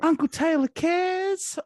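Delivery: high, slow, sugary tagline; mock PSA voice. Telling the kids a safety message, high and slow syrupy announcer. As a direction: sell it like a cheesy ad sign-off.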